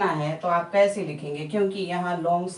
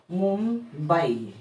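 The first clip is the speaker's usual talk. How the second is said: slow demo; much slower than usual. Shown as a demonstration. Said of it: "Mumbai कहना चाहते हैं".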